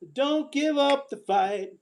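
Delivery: usual speaking voice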